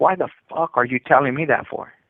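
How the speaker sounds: ordinary talk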